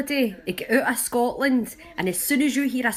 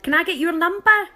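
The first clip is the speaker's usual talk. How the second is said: higher-pitched call across a stairway; raised, rising shouted question; retold shout; 7 semitones higher, 4 dB louder than usual. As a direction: imitate a cheeky lad calling out. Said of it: "and he shouts".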